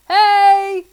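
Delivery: loud high shout to the sky. A narrator's reading. Friday shouting for the rainbow spirit, loud call. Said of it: "he shouted helpfully".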